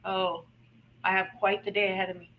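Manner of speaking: low, soft groggy grumble; acted-out weary morning sigh; waking-up reluctance. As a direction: mumble it like someone just waking up, dreading the day. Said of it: "when you wake up in your day, you're like".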